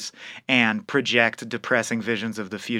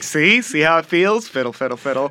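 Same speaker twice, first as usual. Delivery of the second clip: mock menacing taunt; 3 semitones higher than usual.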